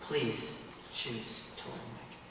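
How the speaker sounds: soft, slow whispered plea. Acted. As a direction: breathe it gently to her. Tender proposal plea.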